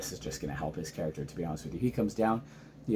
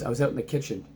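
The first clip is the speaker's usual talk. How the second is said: ordinary talk, nothing marked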